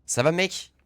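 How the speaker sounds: loud, high question rising at the end; calling out loudly. Real, not acted. Player checks on silent teammate, loud rising call.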